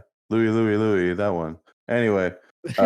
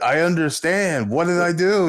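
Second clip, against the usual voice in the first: exasperated; 8 semitones higher than usual. Retold or imitated. Acting out the confused friend.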